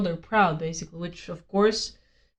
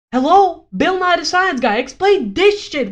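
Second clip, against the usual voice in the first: exasperated shout; 6 semitones higher, 6 dB louder than usual. Real, not acted. Exasperated outburst.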